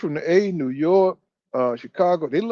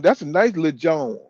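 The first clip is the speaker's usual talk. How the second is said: ordinary delivery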